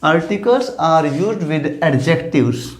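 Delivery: each word spaced out for the class; slow teacher's dictation of a rule. Shown as a demonstration. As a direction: read the rule out slowly, word by word. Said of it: "rule है second".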